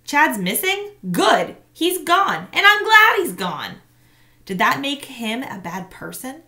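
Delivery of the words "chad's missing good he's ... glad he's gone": high, loud vengeful gloating; boy's fierce secret delight; bitter glee. A narrator's reading. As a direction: spit it out, glad the bully's gone. Boy's vengeful inner reply.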